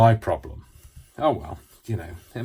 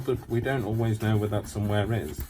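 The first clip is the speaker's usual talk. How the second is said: ordinary talk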